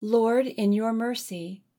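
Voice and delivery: ordinary talk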